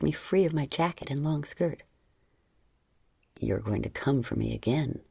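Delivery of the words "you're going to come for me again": lightly voiced man, low and intimate; low male lover voice. A narrator's reading. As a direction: voice him low and close. Lover speaks low, seductive.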